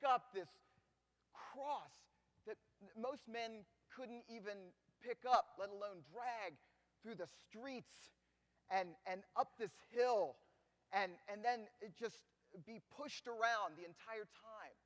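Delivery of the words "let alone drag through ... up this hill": normal talking voice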